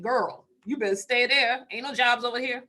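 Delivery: lightly voiced mom telling her daughter to stay put; mom's warning. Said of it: "my mom said".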